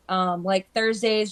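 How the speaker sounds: usual speaking voice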